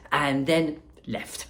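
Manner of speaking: ordinary delivery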